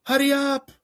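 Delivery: imitating impatient kids; high-pitched mock teenager. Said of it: "the kids are like".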